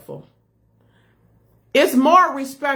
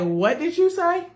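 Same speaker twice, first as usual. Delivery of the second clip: higher-pitched, incredulous question; retold disbelief; 6 semitones higher than usual. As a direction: act out a taken-aback wife questioning her husband. Retelling her shocked reply to husband.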